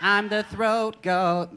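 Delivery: high, loud, drawn-out proclamation; hammed-up high holler; bawdy loud boast. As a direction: announce it big and slow. Bawdy boast shouted for laughs.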